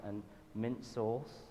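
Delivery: usual speaking voice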